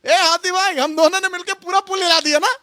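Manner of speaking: high-pitched little mouse voice; boastful mouse. Acted. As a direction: brag to the elephant, high, rising at the end. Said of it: "चूहे का dialogue सुनिए". (ऊँची आवाज़ में डींग मारता चूहा)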